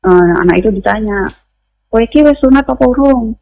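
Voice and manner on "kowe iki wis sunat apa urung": mildly angry mock interrogation, pitched up; retold angry questioning. Neighbour challenging a small boy about circumcision, retold with mild anger. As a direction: imitate an irate neighbour's challenge, higher. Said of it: "Saking marahnya".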